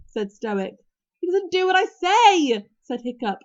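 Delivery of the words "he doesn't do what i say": high-pitched, loud complaint; loud protest. A narrator's reading. Hiccup protesting to his father about Toothless, loud.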